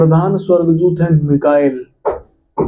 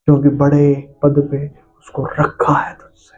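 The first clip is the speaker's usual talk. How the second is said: whispered aside; 3 semitones lower than usual. Real, not acted. Pastor to congregation, whispering the point.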